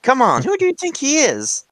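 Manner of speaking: sarcastic. Real, not acted. Mocking a writer as no genius.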